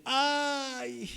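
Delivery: high whiny "¡Ay!" of pain; complaining ouch. Shown as a demonstration. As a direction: moan "¡Ay!" like someone hurting. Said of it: "Se queja, dice".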